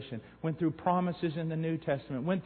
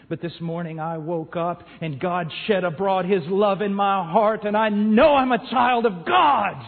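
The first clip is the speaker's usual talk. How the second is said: joyful testimony; 3 semitones higher, 9 dB louder than usual. Retold or imitated. Woman telling the preacher she is saved, rising joy. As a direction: build up to the joy of being saved.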